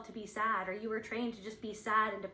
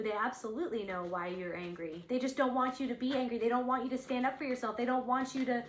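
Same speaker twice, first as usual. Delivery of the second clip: usual speaking voice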